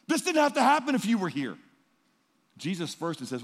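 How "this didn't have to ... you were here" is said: loud, high anguished reproach; Martha's grieving reproach. Retold or imitated. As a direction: cry out at Jesus for coming late. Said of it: "Martha and Mary run out to him and say".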